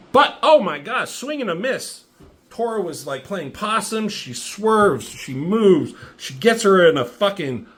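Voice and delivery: high-pitched, animated fight commentary; excited play-by-play. Real, not acted. Reviewer recounting Tora's surprise comeback, excited. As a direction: call the sudden reversal like a commentator.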